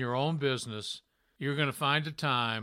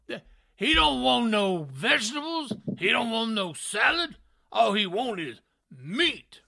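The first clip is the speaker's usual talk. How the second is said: raised, emphatic commentator voice; Booker T impression; 7 semitones higher than usual. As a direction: imitate the commentator's delivery. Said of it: "he talks like that".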